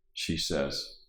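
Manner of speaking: plain reading voice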